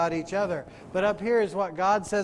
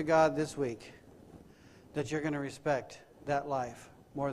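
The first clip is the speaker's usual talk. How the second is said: soft, lowered, searching questions; hushed preaching; 4 semitones lower, 7 dB quieter than usual. Acted. Preacher challenging congregation about this week, hushed solemn preaching. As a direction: bring it right down and let each question sit.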